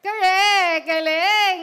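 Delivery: loud, cheering game-show confirmation; excited announcement. Real, not acted. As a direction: shout the win to the room. Host announces a right answer. (masigla at malakas na pagbati)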